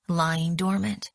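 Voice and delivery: ordinary delivery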